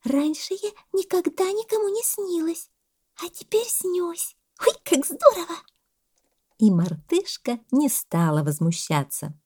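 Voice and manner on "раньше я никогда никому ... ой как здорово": very high little monkey voice; delighted monkey's thought. A narrator's reading. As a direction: marvel to yourself, high and pleased. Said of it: "очень приятная мысль". (мартышка радуется про себя, тоненько)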